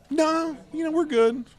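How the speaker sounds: high, breezy no-thanks; mock-casual refusal of an autograph, high; polite brush-off. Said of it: "polite refusal retold".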